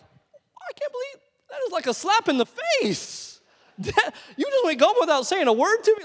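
high, loud indignant disbelief; mock outrage. Son stunned the dad went golfing without him, mock outraged. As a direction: squeal like you were slighted. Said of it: "And I'm like".

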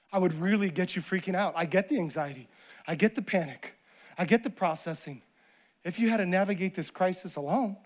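understanding Jesus. Acted. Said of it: "If Jesus were to answer that question, he would say".

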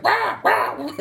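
very high squawking owl noise; owl screech. Retold or imitated. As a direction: screech like a startled owl caught in a sheet. Owl reacting under the sheet.

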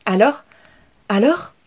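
impatient family eager for news; eager excited. Acted. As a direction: pounce on her with curiosity. Family eagerly greets returning Inès.

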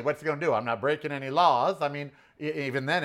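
ordinary talk, nothing marked

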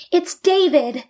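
put-on excited child's yell, high. A narrator's reading. Malka yelling to David at the door, excited. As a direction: shout out his name with joy. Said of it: "Malka yelled".

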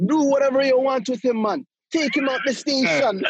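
hammed-up Jamaican accent; old Jamaican man. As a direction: put on a lively Jamaican accent. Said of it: "old Jamaican guy, he's like".

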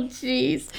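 normal talking voice

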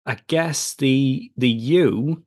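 normal talking voice

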